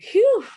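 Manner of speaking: loud, high, hammed-up exhale of exhaustion; imitating a tired sigh; mock weary sigh. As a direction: blow out a big imitated "phew" of fatigue. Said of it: "you see the way you just sighed".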